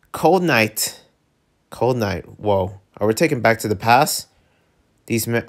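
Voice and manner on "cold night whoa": ordinary delivery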